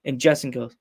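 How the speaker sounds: ordinary talk, nothing marked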